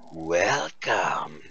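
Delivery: low, rasping welcome; a heavy smoker greeting. Acted. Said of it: "She says".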